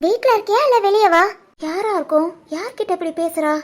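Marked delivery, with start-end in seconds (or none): 1.6-3.5 soft, suspicious undertone; eavesdropper's hushed aside; muttered aside. Acted. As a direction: wonder under your breath.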